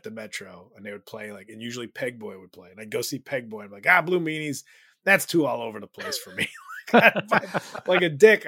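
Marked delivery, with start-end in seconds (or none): 3.9-6.5 loud, higher-pitched griping; mock dismissive. Fan mocking his younger self's verdict on a band, dismissive. 6.5-8.5 loud, high, breaking into laughter; laughing self-mockery. Real, not acted. Fan owning up to past snobbery, laughing self-mockery.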